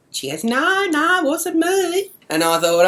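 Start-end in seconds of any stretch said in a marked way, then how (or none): 0.4-2.1 high-pitched imitation of an old lady; old lady's denial. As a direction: protest innocence in a raised voice. Old bird denies it in retelling.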